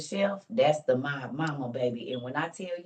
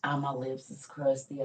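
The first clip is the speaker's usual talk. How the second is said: ordinary delivery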